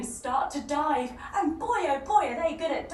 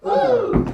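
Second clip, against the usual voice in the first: loud, high ooh; whoop; 9 semitones higher, 12 dB louder than usual. Acted. Whoop after digging move.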